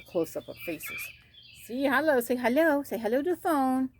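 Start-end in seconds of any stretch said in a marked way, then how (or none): 1.6-3.9 high singsong coaxing; coaxing pet talk. Real, not acted. Keeper coaxing chicks to say hello to the phone, singsong. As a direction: coo it high to little birds. Coaxing young hens to greet viewers.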